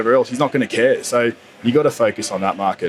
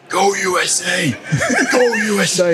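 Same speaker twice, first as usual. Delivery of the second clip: imitated scream; 8 semitones higher than usual. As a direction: call it out high like a screaming supporter. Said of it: "starts screaming at me".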